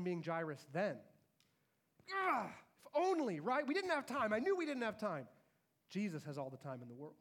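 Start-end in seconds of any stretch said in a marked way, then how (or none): 2.3-5.3 high, loud anguished groan of regret; a grieving dad blaming the delay; pitched-up lament of a desperate father. Acted. Jairus voiced after daughter dies.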